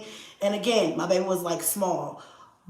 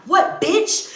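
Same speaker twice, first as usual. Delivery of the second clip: retold challenge; 9 semitones higher, 5 dB louder than usual. Girlfriend's retold insult.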